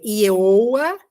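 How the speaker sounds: "Ieoa" run together as one drawn-out vowel glide; demonstrated pronunciation. Shown as a demonstration. Teacher trying out the Hebrew name, vowels glided together. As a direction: say "Ieoa" slowly, gliding I-e-o-a without a V. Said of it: "a pronúncia".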